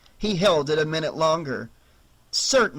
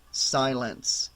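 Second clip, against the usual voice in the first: ordinary delivery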